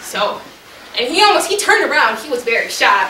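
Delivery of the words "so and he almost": plain reading voice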